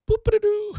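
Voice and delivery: high, loud, playful "boop-a-doo"; imitated pop-up noise. Mocking the popped-up tee shot, high comic sound effect. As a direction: sound out a ball popping skyward.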